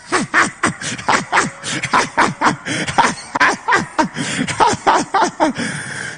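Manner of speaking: long, high, staccato laugh; booming laugh. Real, not acted. Preacher scoffing at demons, long high laughter. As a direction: laugh in rapid high bursts.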